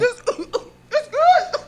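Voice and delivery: high, slow, choked-back cough; strained stoned voice. Retold or imitated. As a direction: pretend it's fine while choking. Imitating choking on smoke.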